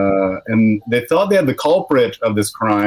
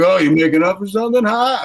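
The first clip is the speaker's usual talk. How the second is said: lightly acted taunt, quick and higher; quick mock taunt with a higher lift; 6 semitones higher than usual. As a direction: needle them, rising on the "huh". Mocking a big truck driver.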